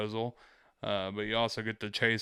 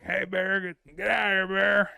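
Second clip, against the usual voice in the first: higher, louder call to scare a bear; mock yell; 9 semitones higher, 6 dB louder than usual. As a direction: holler to shoo off a bear. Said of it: "making a bunch of noise".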